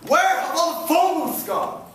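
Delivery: put-upon cry of dismay; exasperated lament. Acted. Exasperated thought amid crowd's phone chatter.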